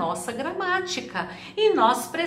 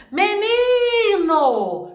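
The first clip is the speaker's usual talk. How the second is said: MENINO called out high, NI drawn long; high, long-held NI in a sung-out MENINO; calling the word; 8 semitones higher, 4 dB louder, much slower than usual. Shown as a demonstration. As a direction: call MENINO as if summoning someone, stretching NI. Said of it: "chama a palavra".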